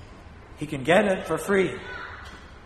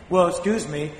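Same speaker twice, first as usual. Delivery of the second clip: usual speaking voice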